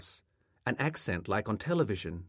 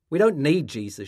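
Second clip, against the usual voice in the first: louder, higher, firm refusal; brusque dismissal; 6 semitones higher, 9 dB louder than usual. A narrator's reading. Mother shutting the door on a missionary, brusque dismissal. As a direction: send the caller away sharply.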